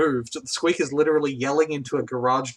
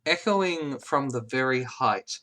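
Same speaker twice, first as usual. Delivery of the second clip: slow echoing narration; 3 semitones lower, 4 dB quieter than usual. A narrator's reading. GM narrates echo scene.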